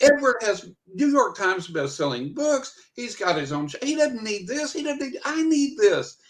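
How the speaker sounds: high, envious protest; mock-outraged rant at the guys; retold yelling. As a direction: complain indignantly, pitch climbing high. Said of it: "I'm just yelling at my guys".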